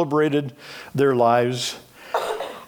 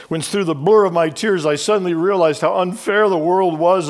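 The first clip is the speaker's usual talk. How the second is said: usual speaking voice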